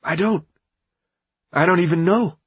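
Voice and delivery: high, near-crying voice; close to tears. A narrator's reading. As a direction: hold back tears as you say it. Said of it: "on the verge of tears".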